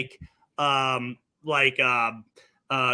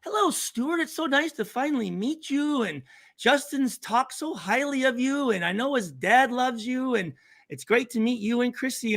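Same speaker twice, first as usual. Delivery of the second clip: much higher, sweet polite hostess voice; polite mom voice; 6 semitones higher than usual. Retold or imitated. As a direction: imitate a gushing, proper mother greeting guests. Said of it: "his mom's like".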